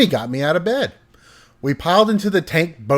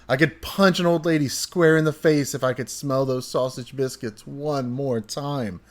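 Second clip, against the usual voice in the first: normal talking voice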